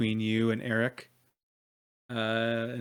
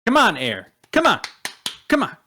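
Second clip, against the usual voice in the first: loud, sharp goading bursts; acted impatient shouting; impatient urging; 7 semitones higher, 8 dB louder, faster than usual. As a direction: push him to answer, louder and louder in short calls. Impatiently urging Eric in role-play.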